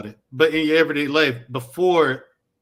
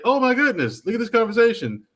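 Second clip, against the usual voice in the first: higher-pitched mock amazement; amazed viewer; 8 semitones higher than usual. People who got on YouTube discovering the conversation, mock amazed. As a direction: lightly act out a wide-eyed discovery.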